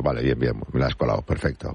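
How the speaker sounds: imitating a listener's knowing smile, low. Listener who sees through the excuse, knowing smile. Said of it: "ya sonríe diciendo".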